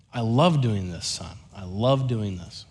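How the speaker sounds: low, soft fatherly voice; tender and hushed, settling a child; gentle reassurance. Retold or imitated. Dad reassures his worried little son, gentle and low.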